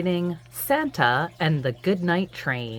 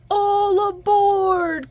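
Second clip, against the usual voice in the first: higher, slow, louder call to board; conductor's call; 7 dB louder, much slower than usual. Acted. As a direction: call them aboard again, sleepily.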